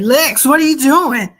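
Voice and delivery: loud, high excited shouting; yelling movie cops. Acted. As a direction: yell it like overeager extras on "Action". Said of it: "somebody yell".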